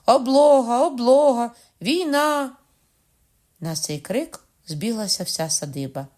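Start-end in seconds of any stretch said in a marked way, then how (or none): 0.0-2.5 put-on high, loud children's battle cry; yelling kids. Children at the door yell at the bride, loud and playful. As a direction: shout it like kids storming a fort. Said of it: "горлали".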